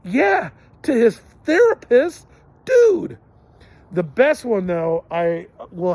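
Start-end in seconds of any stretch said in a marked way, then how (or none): 0.0-3.2 cracking up through the words, much higher and louder; laughing retort. Real, not acted. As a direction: squeal it out while laughing. Laughing retort about the kid.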